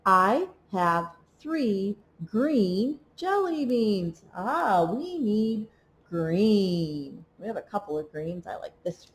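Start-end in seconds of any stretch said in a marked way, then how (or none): 0.0-4.2 slow and even, one word per tap; slow word-by-word reading. Shown as a demonstration. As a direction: read it slowly, tapping each word for a child. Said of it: "Let's read the sentence".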